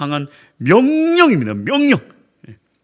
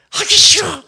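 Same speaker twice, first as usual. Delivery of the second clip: whining; 9 semitones higher, 6 dB louder than usual. Retold or imitated. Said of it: "하면서 운동하는 거야".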